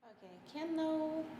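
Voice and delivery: very soft, high, teary voice; collect yourself quietly through tears; real tearfulness. Real, not acted. Mother resumes talking about her son, tearful. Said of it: "I'll try not to cry".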